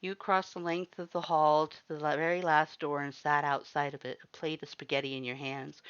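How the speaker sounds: ordinary delivery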